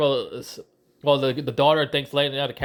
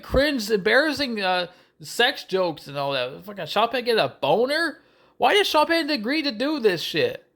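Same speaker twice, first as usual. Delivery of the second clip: exasperated rant; 8 semitones higher than usual. Real, not acted. Reviewer ranting about Sean Penn's role, high and disgusted.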